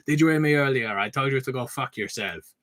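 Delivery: grinning French guy asking if you heard; French-accented man teasing with a big smile. Retold or imitated. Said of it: "as you can tell from the accent".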